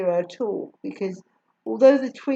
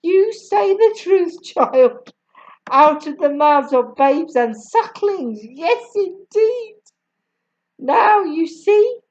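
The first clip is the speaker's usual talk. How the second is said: much higher, slower Jamaican mother, still chuckling; Jamaican accent; 8 semitones higher, 6 dB louder, slower than usual. A narrator's reading. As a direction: Jamaican mum praising the child, then fed up. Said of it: "still chuckling".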